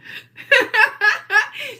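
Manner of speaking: high cackling laugh; laughter. Real, not acted. Cracking herself up mid-rant, high laugh. Laughing at her own joke.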